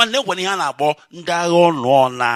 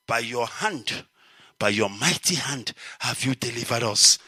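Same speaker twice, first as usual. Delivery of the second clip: much softer, lower, hushed prayer; emotional prayer; 8 semitones lower, 8 dB quieter than usual. Real, not acted. Preacher overcome while quoting the people's prayer, hushed and moved. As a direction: let the emotion pull the voice down. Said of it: "I'm getting emotional now".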